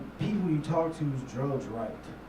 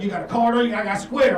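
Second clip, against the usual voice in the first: pushy hobo; 5 semitones higher, 9 dB louder, faster than usual. Retold or imitated. Hobo offers card reader.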